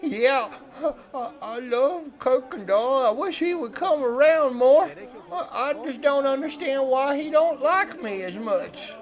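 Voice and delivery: eager fish-camp hand. Acted. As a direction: play a simple, needy fellow, pitched high. Game master plays Cletus.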